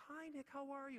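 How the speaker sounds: very high, sugary sing-out; imitating sweet teacher. Teacher greets a parent on the phone, mock-sweet high voice. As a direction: greet like a rainbows-and-butterflies kindergarten teacher. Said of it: "she's made of rainbows and butterflies".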